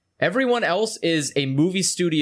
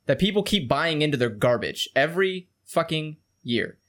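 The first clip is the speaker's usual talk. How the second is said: usual speaking voice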